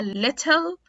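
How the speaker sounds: British "little" with a crisp T; British clear T. Shown as a demonstration. Teacher contrasting with American D, British clear T. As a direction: say little as "lit-tel", real T. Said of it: "في ال British accent".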